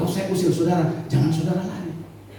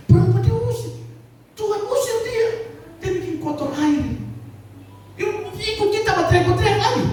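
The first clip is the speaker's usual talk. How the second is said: high, strained accusing voice; mocking accuser. Churchgoers accusing the woman, mocking accuser. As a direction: sneer at the woman from the crowd.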